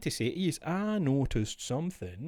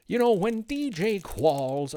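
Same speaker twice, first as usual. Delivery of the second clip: American priest; 8 semitones higher, 5 dB louder, slower than usual. Retold or imitated. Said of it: "why the priest's American".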